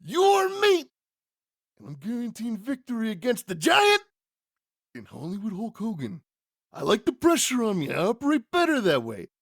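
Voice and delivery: high, intense promo voice, opening on a yell; Macho Man. Acted. Wrestler guaranteeing victory to his interviewer, hammed-up intense impression. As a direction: start shouted, then keep it high and wound up. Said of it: "At which point he says".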